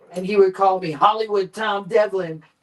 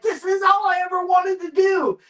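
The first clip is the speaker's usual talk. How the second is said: high, half-sobbing shout of delight; mock cry of joy; 9 semitones higher than usual. Fan on the set, half-crying mock shout of joy. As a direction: wail it like a dream come true. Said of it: "I'm like".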